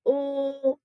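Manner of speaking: "Ooh" as a sustained l sound; isolated, steady phonics l. Shown as a demonstration. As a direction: hold the l on a level note. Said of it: "What about the second syllable?".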